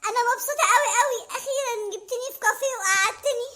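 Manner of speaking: put-on a delighted girlfriend gushing at her date; girly Mona voice. Speaker plays girlfriend Mona.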